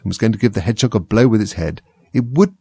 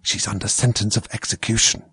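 soft, secretive whisper; 6 dB quieter than usual. A narrator's reading. Rabbit confides in Alice, whispering. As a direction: confide it close to an ear. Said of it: "and whispered".

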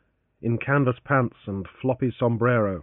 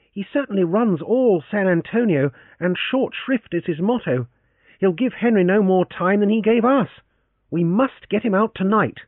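put-on a raised, firm young man's voice; Francis, resolute; 9 semitones higher, 4 dB louder than usual. A narrator's reading. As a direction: lay out the danger and decide. Francis, determined.

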